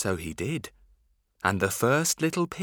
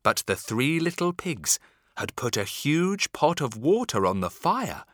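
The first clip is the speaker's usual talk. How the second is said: plain reading voice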